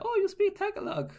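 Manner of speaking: very high, loud surprised question; mock surprise. Filipinos hearing him greet them, high mock surprise. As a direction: imitate a delighted-sounding squeal of surprise.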